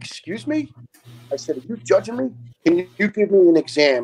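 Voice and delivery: high, questioning retold indignation; indignant. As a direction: act out taking offence, pitched well up. Offended reply to doctor retold.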